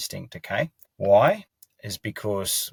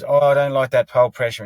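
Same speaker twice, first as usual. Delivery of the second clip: higher, louder voicing of a horse's complaint; 5 semitones higher, 8 dB louder than usual. Trainer speaks for a horse disliking pressure, higher and louder complaint. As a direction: raise it into a protesting horse's voice. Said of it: "on their head goes".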